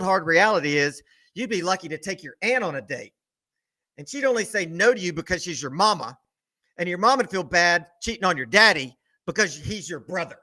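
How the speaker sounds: ordinary delivery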